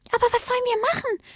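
sehr hohe, schnelle Kinderstimme; winziges Sternchen. A narrator's reading. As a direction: frag ganz klein und schüchtern. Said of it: "fragte ein sehr kleiner Stern". (sehr hohes Stimmchen)

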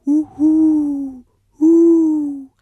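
hoher, lauter, gespenstischer Ruf; unheimliches Eulenrufen. A narrator's reading. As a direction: ruf schaurig aus dem Baum. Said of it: "unheimliche Laute". (gespenstischer hoher Eulenruf)

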